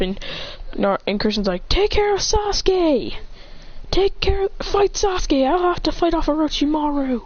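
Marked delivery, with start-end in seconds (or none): 1.7-7.2 high, strained command to an ally; mock anime urgency, pitched up; urgent battle orders. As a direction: call out orders high over the fight. Character shouts orders mid-fight.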